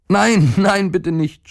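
mock scream without much volume; crying out. A narrator's reading. Boy begging the stomach not to renew, panicked cry. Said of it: "schrie der Junge".

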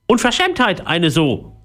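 high, affronted parrot squawk; scolding parrot. A narrator's reading. Parrot scolds Philipp, high indignant voice. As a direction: scold like an offended bird. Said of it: "schimpfte der Papagei".

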